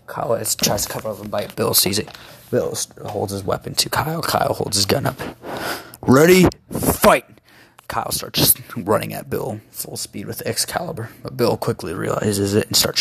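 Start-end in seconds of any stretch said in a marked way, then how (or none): 6.0-7.2 loud, high fight announcer call; announcer shout. Acted.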